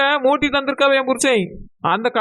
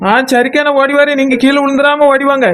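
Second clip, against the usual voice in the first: calling out; 7 dB louder than usual. Acted. Calling back while running.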